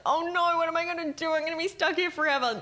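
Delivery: high, panicked imitation; mock panic. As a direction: act out a travel panic. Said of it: "in the moment you're panicked".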